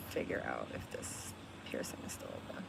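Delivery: very soft, whispered to oneself; absorbed muttering. Real, not acted. Streamer checking a piercing, muttering to self. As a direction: drop to a near whisper while busy.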